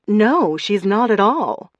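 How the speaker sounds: loud, slow emphatic denial. A narrator's reading. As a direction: stress the no firmly. Emphatic correction.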